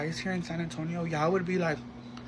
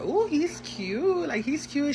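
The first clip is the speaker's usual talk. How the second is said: swooning friend; 8 semitones higher, slower than usual. Retold or imitated. Friend admiring a guy's photo.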